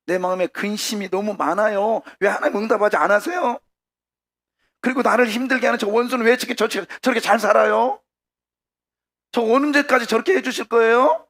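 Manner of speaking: high, loud protest to God; acted complaint. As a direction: rant upward in building exasperation. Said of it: "그러면서 막 불평을 내놓는 거잖아요".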